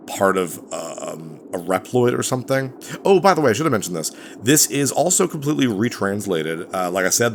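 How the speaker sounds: ordinary talk, nothing marked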